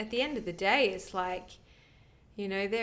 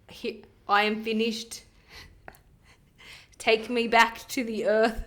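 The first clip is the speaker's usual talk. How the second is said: a touch louder, dignified last words; noble placenta; 6 dB louder than usual. Acted. Placenta asking to return to the earth, dignified last words. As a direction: deliver it as a fallen hero's request. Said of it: "All placentas sound like that".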